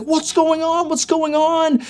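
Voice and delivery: high-pitched frantic mock scream. Friend on the phone hears gunfire, screaming in panic. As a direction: scream it in a strained high voice, not full volume. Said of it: "is screaming".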